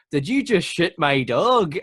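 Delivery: put-on over-the-top Northern Irish accent, loud and slow; Pierce Brosnan. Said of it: "Pierce Brosnan goes".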